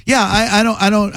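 ordinary talk

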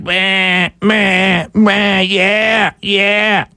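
mocking drawn-out sheep bleats; level, nasal-free mock baa; sheep bleating. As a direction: bleat like a following flock. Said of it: "there go the sheep following right behind".

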